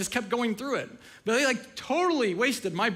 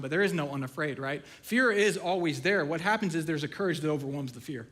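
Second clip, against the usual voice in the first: usual speaking voice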